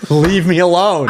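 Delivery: loud, high, drawn-out mock plea; playfully fed-up holler; mock exasperated yell. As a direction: stretch it out as a hammed-up cry to be left in peace. Acting out fed-up reply to friend.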